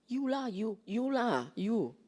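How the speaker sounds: mock Singaporean scolding with a drawn-out lah; Singlish blaming. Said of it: "our Singlish version".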